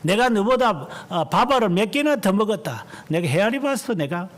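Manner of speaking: high mock bragging; a lightly voiced braggart lording it over a junior; mock senior showing off, pitched high; boastful senior. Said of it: "뭐 이렇게 말하고".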